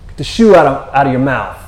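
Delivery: loud dog-owner's reprimand; stern scolding. Acted. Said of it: "like a stern, a stern putting it".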